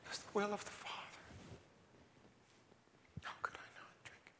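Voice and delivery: soft whispered awe; hushed wonder at the gift; reverent whisper. Acted. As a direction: lean in and whisper.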